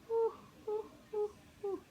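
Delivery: high blowing puffs; quick high blowing; blowing breaths. Pretend. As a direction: blow the clouds away. Blowing dark clouds away.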